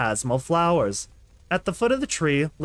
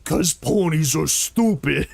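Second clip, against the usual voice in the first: slow, rough orc growl; slower than usual. Acted. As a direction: give him a slow monster growl with laughs breaking in. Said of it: "make him sound like an orc".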